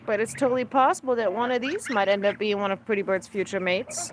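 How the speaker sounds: ordinary talk, nothing marked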